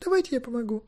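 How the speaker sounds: put-on high, light girlish voice; helpful girl. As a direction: offer help in a high little voice. Girl offers help.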